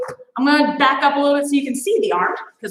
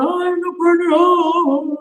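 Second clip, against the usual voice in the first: mock whine; 3 semitones higher than usual. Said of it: "you're going".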